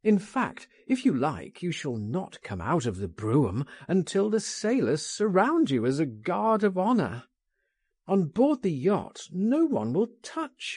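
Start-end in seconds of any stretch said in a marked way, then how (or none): none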